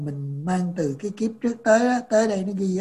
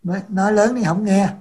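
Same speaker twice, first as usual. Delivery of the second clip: speaking up; 2 semitones higher, 9 dB louder than usual. Real, not acted. Mic trouble, asking to speak up.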